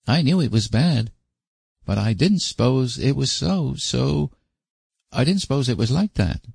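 put-on high, remorseful boy's vow; ashamed little boy. A narrator's reading. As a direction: promise earnestly like a shamed child. Davy ashamed pleading.

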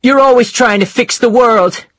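high, loud shout at a husband; full-voiced angry wife shouting. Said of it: "she shouted".

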